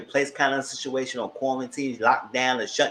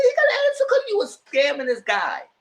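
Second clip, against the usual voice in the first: sassy retort; 12 semitones higher, 4 dB louder than usual. Retold or imitated. Speaker arguing back at Keandre.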